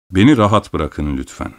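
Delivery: usual speaking voice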